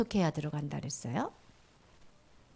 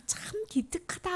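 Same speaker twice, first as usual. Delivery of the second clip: whispered praise; 9 semitones higher, 5 dB quieter than usual. Acted. Jesus praises the youth.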